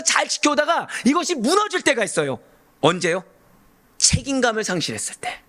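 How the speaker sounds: high-pitched, driving sermon delivery; impassioned preaching. Real, not acted. Preacher expounds Annas's failure to the congregation, fervent preaching. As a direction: preach insistently, stretching key words high.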